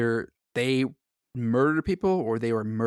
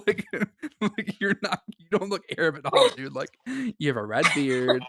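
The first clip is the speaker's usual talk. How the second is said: laughing, pitched way up; 6 semitones higher than usual. Real, not acted. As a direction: break into laughter mid-sentence. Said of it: "laughing at brother's claim".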